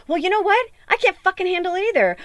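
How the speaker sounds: high exasperated retort; exasperated outburst. Retold or imitated. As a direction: voice jumps high, snapping back at him. Retold outburst at partner.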